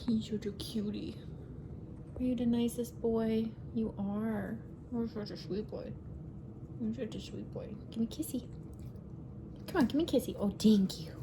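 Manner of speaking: quiet cooing to a pet; soft dog talk. Real, not acted. Talking softly to her dog Blue, tender cooing. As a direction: coo it softly like to a sweet pup.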